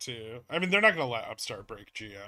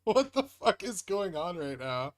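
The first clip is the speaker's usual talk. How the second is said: disbelief; 5 semitones higher than usual. Real, not acted. Market suddenly moves.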